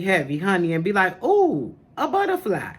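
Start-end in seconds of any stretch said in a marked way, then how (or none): 1.2-2.7 high, bright-eyed gasp at a butterfly; suddenly delighted, like a kid spotting something shiny; sudden distraction. Retold or imitated. Talking about her wandering mind, squeals at a butterfly.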